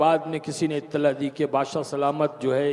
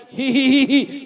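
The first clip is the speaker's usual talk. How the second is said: high, louder he-he-he laugh; maid's giggling; 8 semitones higher, 6 dB louder, slower than usual. Retold or imitated. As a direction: imitate the maid laughing under the whip. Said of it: "फिर हंसने लगी". (कोड़े खाती बांदी की ऊँची हँसी)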